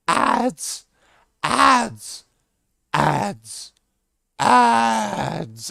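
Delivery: high, slow, drawn-out put-on greeting; odd stranger voice. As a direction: stretch every word, high and strange. Stranger calling again.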